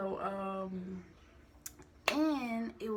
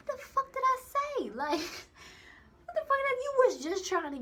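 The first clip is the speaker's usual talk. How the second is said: much higher, louder indignant squeal; outraged disbelief; 5 dB louder than usual. Retold or imitated. To the manager after his rant, high outraged disbelief. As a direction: shriek it like you can't believe him.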